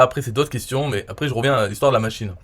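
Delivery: usual speaking voice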